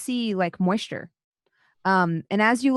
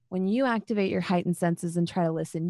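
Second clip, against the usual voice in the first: ordinary delivery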